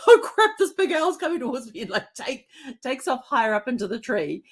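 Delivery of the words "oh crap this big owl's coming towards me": high squeaky voice of a scared little bird; panicked little bird. Retold or imitated. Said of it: "The little bird's like".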